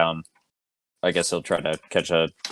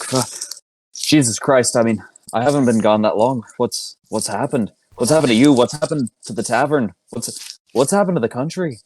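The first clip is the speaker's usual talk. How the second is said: shocked; 6 semitones higher than usual. Acted. Player shocked at ruined friend.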